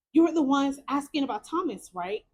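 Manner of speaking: ordinary delivery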